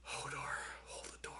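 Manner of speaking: breathless whisper, barely there. Real, not acted. Alone after the episode, whispering goodbye to Hodor. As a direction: hush it like a private farewell. Grieving echo of Hodor's words.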